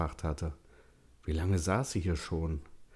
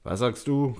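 louder, higher call across a distance; 6 semitones higher, 6 dB louder than usual. A narrator's reading. Man calls to his wife walking ahead, loud. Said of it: "rief er ihr zu".

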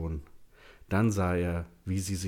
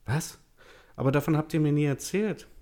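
much higher, louder stunned outburst; shocked; 9 semitones higher, 5 dB louder than usual. A narrator's reading. Daughter learns her mother lost a child, shocked. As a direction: blurt it out in disbelief.

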